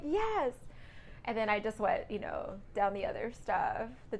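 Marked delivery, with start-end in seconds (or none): 0.0-0.5 high, eager yes of relief; relieved yes. Retold or imitated. Trainee answers the instructor's prompt with an eager yes. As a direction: blurt it out, glad to finally know. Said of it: "I'm like".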